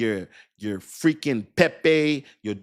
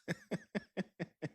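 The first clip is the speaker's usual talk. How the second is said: laughing; 5 semitones higher, 16 dB quieter than usual. Real, not acted. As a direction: burst of real laughter. Laughs at own playful boast.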